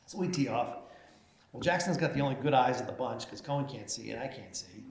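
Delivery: usual speaking voice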